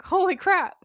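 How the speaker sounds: a loud, high holy crap; amazed exclamation. Retold or imitated. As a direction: blurt it out, stunned. Gary admiring his new body.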